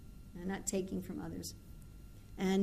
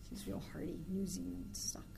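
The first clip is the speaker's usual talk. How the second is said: quiet aside; 8 dB quieter than usual. Real, not acted. Aside about housemate.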